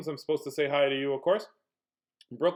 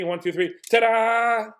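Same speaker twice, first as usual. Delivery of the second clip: ta-da shouted bright and high; mock magician's flourish on the reveal; hammed-up ta-da, louder on the last word; magic reveal; 8 semitones higher, 8 dB louder than usual. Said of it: "Here, I'll do magic".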